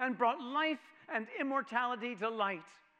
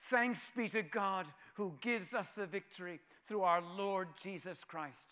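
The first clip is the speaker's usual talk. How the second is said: normal talking voice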